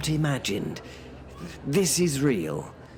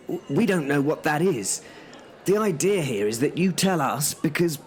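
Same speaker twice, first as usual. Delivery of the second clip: ordinary talk, nothing marked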